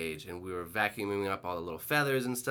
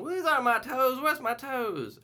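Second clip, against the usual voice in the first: toenail lady; 4 dB louder than usual. Retold or imitated. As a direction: stretch it out like a lost grandma. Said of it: "toenail lady comes wheeling in".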